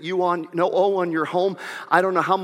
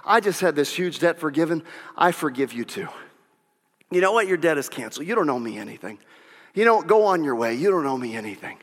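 gentle forgiving servant; 7 semitones lower, faster than usual. Acted. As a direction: release him tenderly. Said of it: "for the servant to say".

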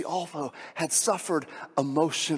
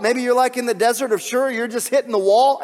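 ordinary talk, nothing marked

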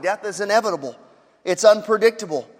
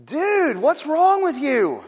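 higher and slow, a disbelieving rebuke; exasperated criminal; 7 semitones higher, much slower than usual. Acted. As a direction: lean into "wrong", incredulous. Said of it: "and he goes".

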